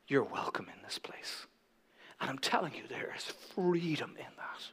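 soft, low, near-whisper; hushed preaching. Real, not acted. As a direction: confide gently in the congregation.

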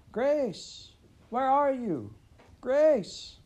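high loud shout of the name; distant shouting. A narrator's reading. Parents shouting for the lost child from the slough, loud and high. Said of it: "came Pa's shouts and Ma's".

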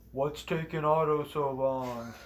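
slow, loud call from outside. Retold or imitated. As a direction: call through the door. Calling from outside the bathroom.

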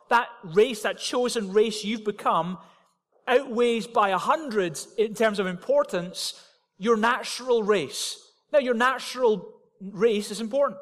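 building sermon intensity; rising preaching. Acted. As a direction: drive the point home to the congregation. Sermon climbing to full force.

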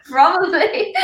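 giggling through it; laughing. Real, not acted. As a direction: laugh the word out. Joking agreement.

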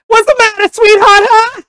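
mock a grumpy comedian needling someone; Gilbert Godfrey. Trying Bill Burr angry, comes out squawking Gilbert Godfrey voice. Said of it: "that just became Gilbert Godfrey".

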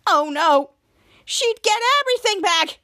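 high, alarmed oh no from a retold scene; a touch of mock panic, voice shooting high; panicking. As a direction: kid acting a panicked girl, pitch jumping up. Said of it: "Charlotte panicking".

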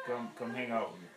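normal talking voice

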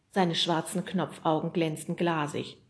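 ordinary delivery

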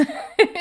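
short amused chuckle; quick laugh at own joke; light laugh. Real, not acted.

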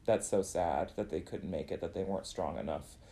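soft, pitying tone; quietly pitying the ones who left. Retold or imitated. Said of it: "I would just be like".

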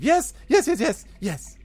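a fast, high rising run of "yes"; goblin babbling excitedly. Acted. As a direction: babble "yes" and build, then settle. Goblin pointing at tracks.

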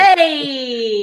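long, held catchphrase "Hey"; Fonzie. Acted. Podcaster butting in with a catchphrase, Fonzie style. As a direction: stretch it out like a sitcom greaser.

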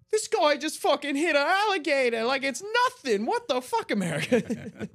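put-on very high, loud, shocked British accent; British impression. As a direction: gasp at America in a British accent. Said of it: "it cuts to the British guy going".

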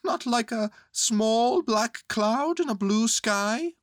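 high, slow anxious bear voice. A narrator's reading. Bear worried about his disguise, anxious high voice. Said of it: "said Pooh anxiously".

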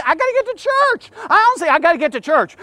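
high, rushed excuse of a busy churchgoer; hurried churchgoer. Acted. Churchgoer passing the beaten man, hurried excuse. Voices passerby in Samaritan story.

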